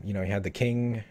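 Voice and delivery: normal talking voice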